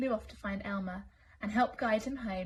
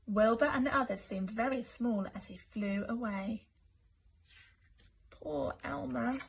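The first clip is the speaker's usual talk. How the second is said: plain reading voice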